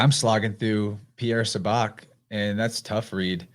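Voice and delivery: normal talking voice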